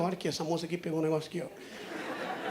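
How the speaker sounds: fast, very soft sneaky commentary; low, quick, fading to a whisper; hushed aside. Acted. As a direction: murmur secretly to your phone camera. Covertly narrating while filming passengers.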